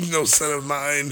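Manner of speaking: slow mock-operatic disowning. Father disowning his son, slow mock-operatic line. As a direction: intone it like a tragic opera father. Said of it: "a whole sad opera".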